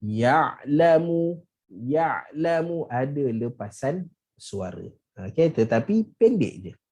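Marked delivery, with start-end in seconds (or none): none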